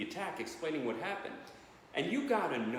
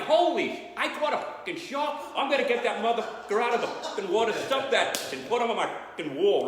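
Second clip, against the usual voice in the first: higher and louder, thrilled at catching the shark; excited; 6 semitones higher, 6 dB louder than usual. Retold or imitated. Fisherman in the Brooklyn joke, yelling with excitement. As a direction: shout it like you just hooked a monster. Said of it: "I'm like".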